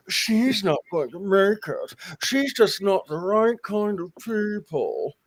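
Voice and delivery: slow, jaw-clenched lockjaw snobbery; Locust Valley lockjaw. Retold or imitated. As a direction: lockjaw accent, cold and slow. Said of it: "the Locust Valley lockjaw".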